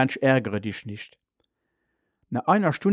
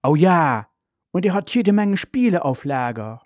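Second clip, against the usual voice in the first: put-on delighted, higher-pitched child's reply; freudig; 5 semitones higher than usual. A narrator's reading. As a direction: cheer happily at the news. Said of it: "Charlotte freut sich". (freudig, mit angehobener Stimme)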